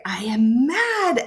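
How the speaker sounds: drawn-out, high stress on "mad"; mad, emphatic. Shown as a demonstration. Teacher showing an exclamation point, mad and emphatic. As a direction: stretch "mad" out slowly as an angry exclamation. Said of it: "I'm adding more emphasis".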